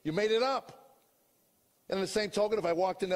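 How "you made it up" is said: higher, louder, scoffing challenge; mocking inner voice. Skeptic mind mocking the prayer, higher and louder scoffing. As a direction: sneer it like a doubting voice in your head. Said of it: "your brain is chirping".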